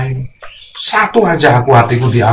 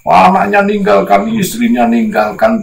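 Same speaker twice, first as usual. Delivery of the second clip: ordinary talk, nothing marked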